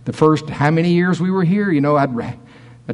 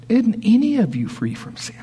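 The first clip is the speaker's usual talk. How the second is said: whispered question; 8 semitones higher than usual. Retold or imitated. Jesus quoted quietly.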